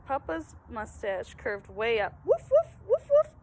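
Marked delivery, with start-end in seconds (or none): 2.2-3.3 very high, loud happy yaps; a rescued puppy barking with joy. Acted. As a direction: bark like a delighted little dog. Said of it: "barked Francis".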